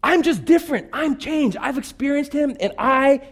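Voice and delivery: high, pleading preacher's cry; preaching at full force. Acted. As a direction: pour it out, unable to hold back. Passionate climax of sermon.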